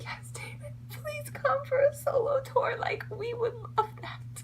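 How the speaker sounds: high breathy squealing whisper; excited fan whisper. Retold or imitated. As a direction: gush it in a tiny high whisper. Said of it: "I was really happy because I was like".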